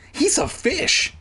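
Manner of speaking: high-pitched astonished cry; shocked, high exclamation; cried out. Acted. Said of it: "Sheena cried".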